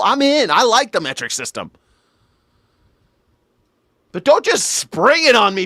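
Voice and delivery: hammed-up indignant rant; mock outrage. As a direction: yell at Brian in mock panic. Ranting at Brian, mock outrage.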